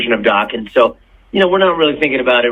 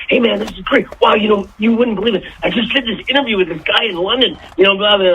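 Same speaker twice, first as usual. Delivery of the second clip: imitating a thrilled buddy, pitched higher; excited friend; 8 semitones higher than usual. George tells his friend about the London interview, mock excited. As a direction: gush about the big interview. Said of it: "He goes".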